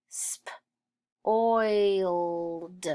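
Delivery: voiceless "sp", pause, drawn-out "oiled"; sounded out. Shown as a demonstration. Teacher sounding out spoiled for pupils, sounded out. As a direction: separate sp from the rest.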